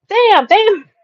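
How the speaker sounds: high, stunned double take; dismayed realization. Retold or imitated. Visitor realizing he got hooked, stunned. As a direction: sound caught out at last.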